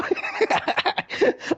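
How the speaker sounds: breathless high wheeze of a laugh; squeaky laugh. Real, not acted. Friend wheezes with laughter after the silly taunt.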